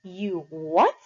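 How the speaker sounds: loud incredulous snap; shouting disbelief. A narrator's reading. Derry shouting at Pony's excuse, incredulous. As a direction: bark it back in disbelief. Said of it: "He was shouting".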